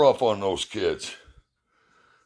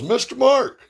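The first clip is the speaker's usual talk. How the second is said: hailing; 14 semitones higher, 3 dB louder than usual. Retold or imitated. Coach pulling up beside Mister Mark, high hailing call. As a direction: imitate a coach hailing a friend. Coach pulls up and hails him.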